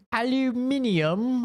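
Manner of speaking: high, drawn-out mock British accent. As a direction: stretch Aluminium out in a silly British accent, pitched well up. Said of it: "My British homie speaks exactly like that".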